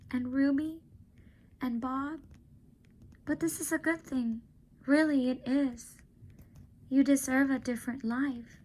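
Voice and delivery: put-on a girl saying goodbye to her friend; young girl Julia. Julia voiced higher.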